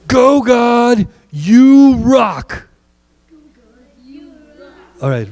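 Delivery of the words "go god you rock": high, drawn-out stadium yell; rousing fan holler; demonstrated cheer. Shown as a demonstration. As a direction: teach them the chant at full shout. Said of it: "We'll say".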